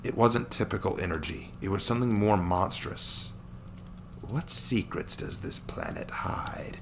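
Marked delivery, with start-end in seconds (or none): 4.2-6.8 slow, voiceless, gleeful whisper; sly villain whisper. Acted. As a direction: relish the mystery like a predator. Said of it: "he chuckled".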